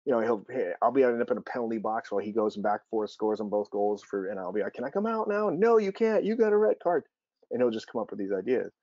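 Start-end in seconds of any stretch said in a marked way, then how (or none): 4.7-5.5 high, mock-pleading ask; pleading dad. Dad in the penalty box asks his son, high and mock-pleading. 5.5-7.0 put-on high, loud little-kid ruling; son's bossy ruling. Dad voicing his son refusing him, high and loud like a child.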